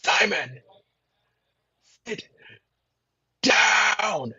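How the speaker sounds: werewolf. Pretend. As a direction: roar it like a werewolf. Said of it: "deepest werewolf voice".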